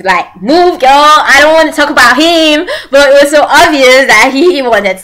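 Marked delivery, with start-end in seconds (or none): 0.4-2.7 higher-pitched mock-defensive snap; defensive brush-off. He refuses to talk about Kim Tan, mock-defensive brush-off. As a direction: imitate someone refusing the subject, pitch raised. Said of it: "he got all protective".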